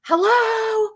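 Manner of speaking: high, loud call of hello; mock scream. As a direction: belt out a startled hello into the lobby. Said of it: "and she screams".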